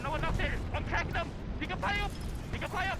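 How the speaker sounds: high urgent callout; excited callout. Real, not acted. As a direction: call it out fast to the team. Calling out during a fight.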